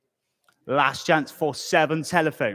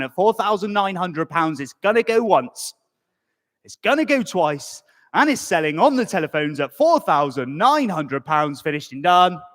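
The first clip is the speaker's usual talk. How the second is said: auctioneer countdown; 3 semitones higher, 3 dB louder than usual. Acted.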